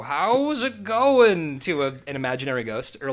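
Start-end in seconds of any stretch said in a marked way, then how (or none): 0.0-1.6 put-on slow, high, drawn-out old man voice; mock old man greeting. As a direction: stretch it out like a frail old husband saying hi. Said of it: "have the guy go".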